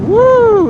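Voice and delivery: high, loud whoop; excited whoop. Real, not acted. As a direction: let out a startled whoop. Sudden wind gust at speed.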